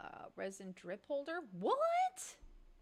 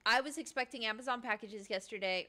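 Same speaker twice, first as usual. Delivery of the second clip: ordinary talk, nothing marked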